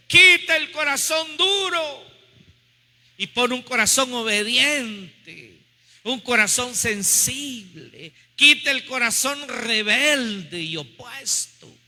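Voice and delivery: slow, insistent plea, launched high; fervent prayer. Real, not acted. Preacher begging for an obedient heart, fervent prayer. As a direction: implore God with long drawn-out words.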